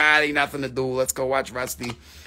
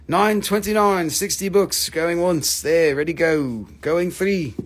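ordinary talk, nothing marked

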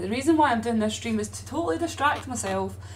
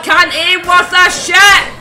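slow, loud, exasperated complaint; fed-up loud gripe; frustrated yell; 5 semitones higher, 13 dB louder, slower than usual. Real, not acted. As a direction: shout it out in annoyance. Frustrated yelling during clip.

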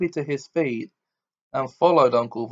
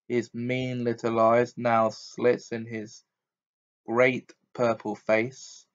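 ordinary delivery

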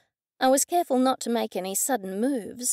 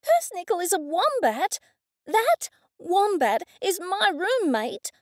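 Laura's voice; 8 semitones higher than usual. A narrator's reading.

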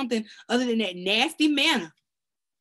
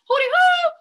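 high loud calling hoot; hooty hoo call; 9 dB louder than usual. Acted. Preacher calling out to God, high hoot.

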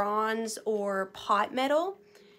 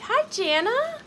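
ordinary talk, nothing marked

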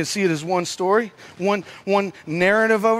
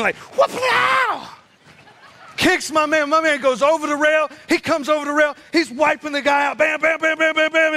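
high-pitched blow-by-blow with sound effects; excited fight retelling; 7 semitones higher than usual. Retold or imitated. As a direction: relive the fight move by move, thrilled.

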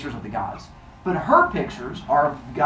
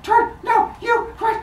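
very high, squeaky mock panic; panicked crowd. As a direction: squeal urgent warnings. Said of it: "the crowd starts screaming".

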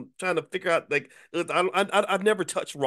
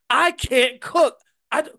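slow, loud, much higher insistent wail; loud high protest; 12 semitones higher, 7 dB louder, slower than usual. Retold or imitated. Refusing his wife, a slow loud high wail. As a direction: wail it out like a stubborn child refusing. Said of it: "I said".

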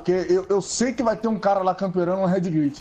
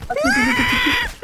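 high shouted callout; urgent calling out. Real, not acted. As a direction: yell to get a teammate's attention. Calling teammate during firefight.

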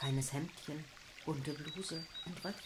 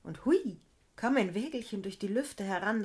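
plain reading voice

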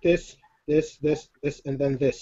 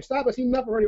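imagined audience protest; 7 semitones higher, faster than usual. Pretend. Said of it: "by way of saying".

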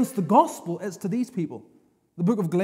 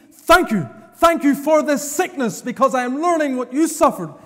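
loud, ringing thanksgiving proclaimed from the pulpit; full-force preaching; 5 semitones higher, 6 dB louder than usual. Acted.

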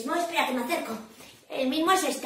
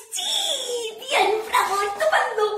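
high, laughing bursts of praise; jubilant cheering; 10 semitones higher, slower than usual. Acted. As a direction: cheer wildly for the win. Cheering a correct guess.

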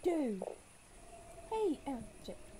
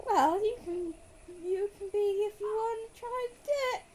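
higher, louder character voice, hammed-up; put-on voice; 9 semitones higher, 10 dB louder than usual. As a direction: ham up a silly new voice for the character. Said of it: "So let's go with this voice".

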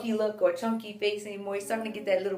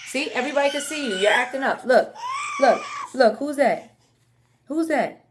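mock scolding; 4 semitones higher, 5 dB louder than usual. Real, not acted. Playfully scolding baby on camera.

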